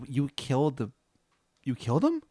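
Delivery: halting, rising disbelief; falter, then lift into an incredulous question; stunned disbelief. Acted. Linda learning you killed the Minotaur, stunned disbelief.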